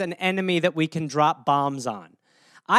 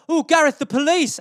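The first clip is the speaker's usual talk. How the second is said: mock alarm; 8 semitones higher, 5 dB louder than usual. Friends teasing him about police.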